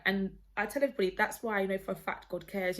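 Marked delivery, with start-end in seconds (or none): none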